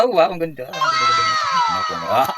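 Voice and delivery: high, loud gushing over the bottle; excited shout. Acted. Vlogger thrilled by her ketchup bottle, gushing shout. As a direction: shout your delight at the product.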